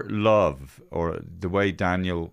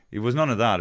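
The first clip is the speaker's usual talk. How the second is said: usual speaking voice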